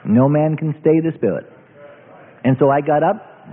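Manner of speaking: normal talking voice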